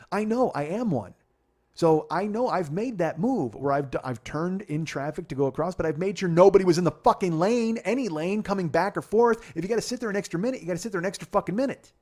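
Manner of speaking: loud, high-pitched, emphatic outburst; heated rant. Real, not acted. Admitting own risky turns to listeners, loud heated rant. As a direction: raise your voice and hammer the point home.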